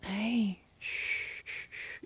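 soft whispered shushing; soothing shush. Acted. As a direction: hush him gently, barely a breath. Calming him.

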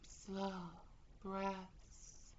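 ordinary talk

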